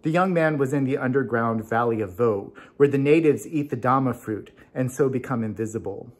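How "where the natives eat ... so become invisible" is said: ordinary delivery, nothing marked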